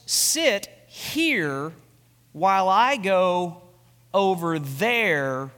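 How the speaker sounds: solemn slow Jesus voice; voicing Jesus. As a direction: stretch each word as Jesus speaks.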